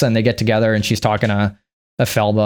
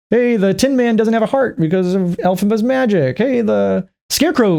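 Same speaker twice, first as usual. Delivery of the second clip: mock townsfolk; 8 semitones higher than usual. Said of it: "the whole town convinced her".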